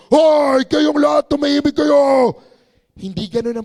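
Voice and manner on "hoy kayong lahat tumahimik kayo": loud mock shout at everyone. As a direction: yell for them to hush, hammed up. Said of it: "feeling nyo ba si Lord ganito siya". (pabirong sigaw na malakas)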